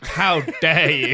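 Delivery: loud, drawn-out, theatrical indignation; mock outrage. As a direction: act deeply affronted, stretching the words slowly. Mock outrage at film suggestion.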